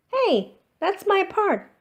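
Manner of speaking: put-on high, loud indignant girl; outraged child claiming her part; indignant protest. Sophie protests her role taken.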